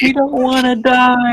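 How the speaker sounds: high crying plea to live; death cry. Acted. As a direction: beg for life through sobs. Dying animals begging for life.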